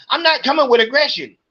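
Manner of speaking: high, fast, snippy retort; mimicking the clerk's indignant denial; mocking the clerk. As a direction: throw her denial back high and quick.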